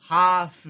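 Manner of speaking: half as hafu, no l; Japanese loanword. Shown as a demonstration. Teacher giving the Japanese form of half: ハーフ. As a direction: drop the l from half. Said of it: "se vuelve en".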